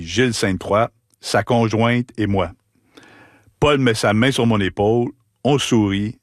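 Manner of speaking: ordinary delivery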